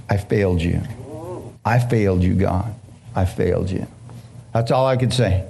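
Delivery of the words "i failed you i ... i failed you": low, soft repeated confession; mild retold weeping. Preacher in bed crying to God, low and soft. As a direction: murmur it like someone worn out from crying.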